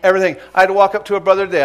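loud, high, ringing preacher's call; sermon climax, preacher rousing the congregation, loud and high; full-force preaching. Real, not acted. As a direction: preach it out to the whole church.

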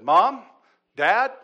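high, loud child's call, imitated; calling for parents. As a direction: call out for Mom and Dad, each word separate. Retold son calls for parents.

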